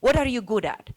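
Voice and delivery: usual speaking voice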